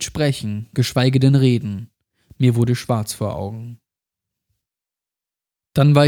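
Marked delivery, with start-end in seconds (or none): none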